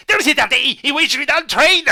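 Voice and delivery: high, loud manic shout; crazy voice. Acted. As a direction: scream it like a fanatic. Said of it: "go for a crazy voice".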